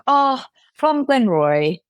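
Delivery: cheeky reply. Retold or imitated. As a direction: play it deadpan and cheeky, drawn out a little. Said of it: "me very cheekily".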